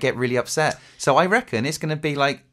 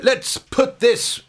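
pompous politician voice; 8 semitones higher, much slower than usual. Retold or imitated. I reckon he went.

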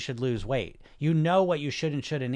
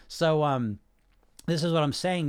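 ordinary talk, nothing marked

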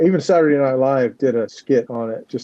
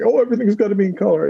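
mock eager self; 8 semitones higher, faster than usual. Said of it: "I tend to like".

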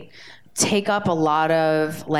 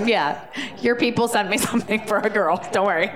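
laughing reassurance; 3 semitones higher than usual. Retold or imitated. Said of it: "I was like".

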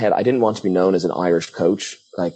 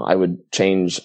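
usual speaking voice

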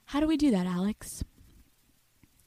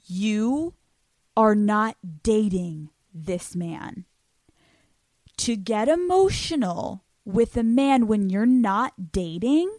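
normal talking voice

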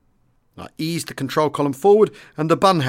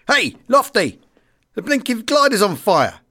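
high, raised alarm call; calling up urgently; 8 semitones higher, 4 dB louder than usual. Acted. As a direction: shout up to the pilot about danger. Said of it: "A voice called up from down below".

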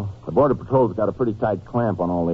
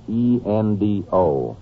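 spelled out slowly; much slower than usual. Acted. Spelling out partial letters.

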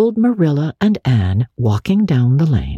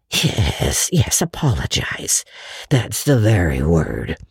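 put-on old man relieved the word is found; eager Matthew; 8 semitones lower, slower than usual. A narrator's reading. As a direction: lower voice, mildly eager. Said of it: "said Matthew eagerly".

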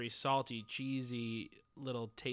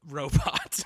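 robot troll voice; 2 semitones higher than usual. Pretend. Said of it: "I don't wanna do this voice anymore".

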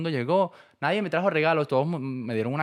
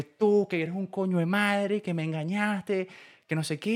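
mock angry yell; 6 semitones higher, slower than usual. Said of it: "me empezó a gritar".

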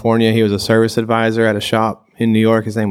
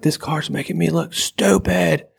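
high, drawn-out complaint; mock tantrum; 7 semitones higher, slower than usual. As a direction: imitate a guy throwing a fit, slowly. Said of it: "he's throwing a fit".

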